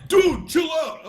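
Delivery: high-pitched mock yell; retold exasperated protest. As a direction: protest loudly, acting out exasperation. Retold reaction to being misread.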